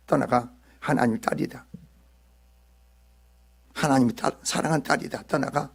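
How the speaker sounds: low, hushed command to a demon; murmured low exorcism prayer; quiet rebuke. Acted. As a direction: order the spirit out softly, in a low voice. Acts out commanding the demon away.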